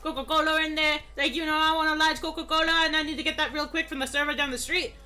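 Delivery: loud, high hammed-up broad Australian accent; broad Aussie accent. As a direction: ham up a rowdy Aussie bloke ordering coffee. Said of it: "some people who are like".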